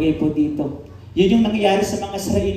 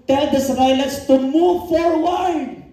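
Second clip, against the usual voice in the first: slow, raised solemn command; voice of God; 6 semitones higher, 3 dB louder, slower than usual. Acted. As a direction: proclaim it as God's order. The Lord commanding, other translation. (mataas at mabagal na utos ng Panginoon)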